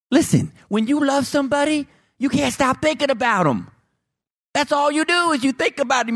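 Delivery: high, climbing preacher fervor; rising excitement. Acted. Pastor to the congregation, climbing into excited fervor. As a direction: lift the pitch as the point builds.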